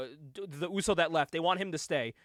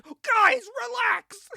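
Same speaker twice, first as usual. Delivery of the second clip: much higher, mock calming voice; imitating a wrestler breaking up a shouting match; mock peacemaker; 13 semitones higher, 4 dB louder, much slower than usual. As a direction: play the guy stepping in to calm them. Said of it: "Jerry Lynn comes out and is like".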